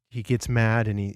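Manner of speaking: normal talking voice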